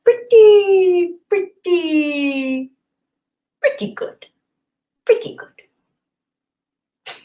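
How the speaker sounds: high, strained Larry David drawl on pretty good; doing the Larry David pretty good bit. Retold or imitated. Streamer answers a fan in a Larry David voice. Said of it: "I'm gonna do this in the Larry David voice".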